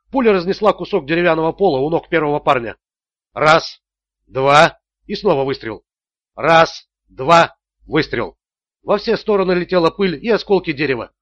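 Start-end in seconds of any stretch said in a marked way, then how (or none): 3.3-4.8 counting out loud, firm and forceful. A narrator's reading. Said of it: "Ричард громко начал считать". 6.3-7.5 counting out loud, firm and forceful. A narrator's reading. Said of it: "Ричард громко начал считать".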